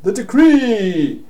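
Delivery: high, loud wail with DECREE drawn out; desperate shout. Acted. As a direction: call after them in dismay. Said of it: "he shouts off".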